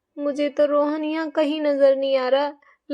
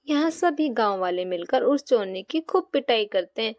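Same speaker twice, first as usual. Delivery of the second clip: much lower, even storyteller voice; children's story narrator; story narration; 6 semitones lower than usual. A narrator's reading. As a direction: narrate the punishment calmly.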